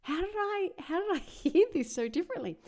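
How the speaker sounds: high, startled self-quote with a laugh; "how did I" jumps high in mock dismay; retold disbelief. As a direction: recall the shock of hearing your own mistake. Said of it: "And I was like".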